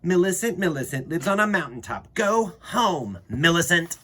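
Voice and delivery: high, loud taunting chant; children yelling. A narrator's reading. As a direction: jeer her out of the valley. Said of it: "all the children started to yell".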